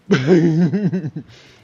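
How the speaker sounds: sudden loud burst of laughter; a real, loud laugh. Real, not acted. Laughing at the enemy.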